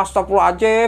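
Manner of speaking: mock yell of alarm; retold startled yell. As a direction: cry out as if caught by surprise, retelling it. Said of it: "Gua langsung teriak".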